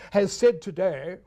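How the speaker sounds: lightly imitated BBC news voice; BBC newsreader. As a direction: keep the stiff newsreader tone. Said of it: "a voice would go".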